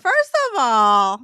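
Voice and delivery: loud slow protest; loud, slow, mock-offended protest; indignant. Real, not acted. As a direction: push back loudly and slowly. Defending herself, laughing.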